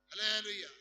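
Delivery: loud preacher's cry of hallelujah; ringing hallelujah shout. Real, not acted. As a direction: call it out to the hall.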